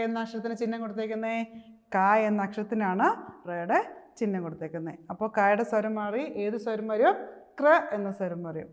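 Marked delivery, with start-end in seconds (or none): none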